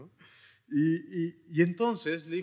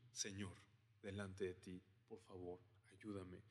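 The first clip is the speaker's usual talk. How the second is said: much softer, a near-broken begging; humble plea; 6 semitones lower, 20 dB quieter than usual. Acted. The Canaanite woman humbles herself before Jesus, pleading very softly. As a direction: sink low and beg, almost under the breath.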